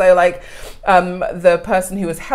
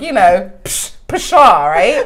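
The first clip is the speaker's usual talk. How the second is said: ordinary talk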